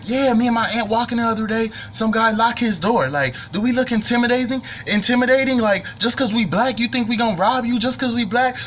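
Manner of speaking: overacted indignation for the room; mock indignant speech. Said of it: "this whole speech".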